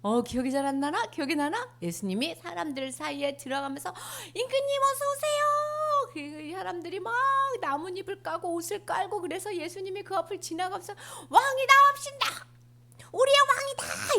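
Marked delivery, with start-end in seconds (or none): 4.3-6.1 high, slow joyful welcome; crowd greeting. Acted. Crowd greets Jesus entering. 11.3-14.1 high breathy cry of welcome; excited hailing. Acted. Crowd hails the king.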